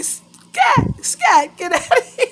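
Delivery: high, loud "scat" shout; shooing yell. Retold or imitated. Shooing a bug out of the bathroom, loud high yell. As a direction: shoo it away, yelling. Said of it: "And going".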